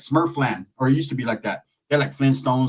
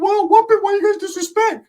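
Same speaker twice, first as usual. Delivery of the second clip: very high cartoonish protest; Ricky. Cousin challenging the motel argument, squeaky put-on voice. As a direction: squeak it out as a mock-offended impression. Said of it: "Ricky's like".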